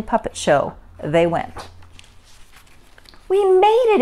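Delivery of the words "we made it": high, loud excited cry; exclaiming. A narrator's reading. Ava arrives at the theater in time, excited exclamation. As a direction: exclaim in storybook triumph. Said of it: "Ava exclaimed".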